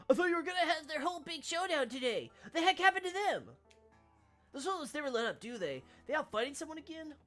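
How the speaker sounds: put-on high, quavering old man; lightly voiced grumpy old man.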